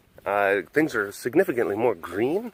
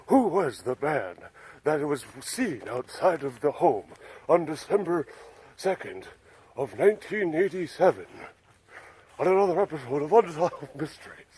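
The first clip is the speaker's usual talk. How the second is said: imitating Robert Stack; 4 dB quieter than usual. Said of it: "He had that really distinctive voice".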